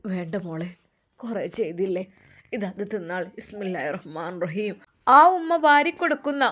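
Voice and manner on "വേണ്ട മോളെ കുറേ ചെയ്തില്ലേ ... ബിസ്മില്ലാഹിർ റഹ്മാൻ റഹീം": tender consoling mother. Acted. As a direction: lower and softer, a mother's gentle comfort. Mother feeding daughter tenderly. (താഴ്ന്ന, മൃദുവായ, ആശ്വസിപ്പിക്കുന്ന ഉമ്മയുടെ ശബ്ദം)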